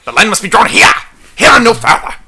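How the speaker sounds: lightly regal commanding captain; Patrick Stewart. Retold or imitated. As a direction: declaim it like a starship captain. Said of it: "Patrick Stewart's got this great, very regal voice".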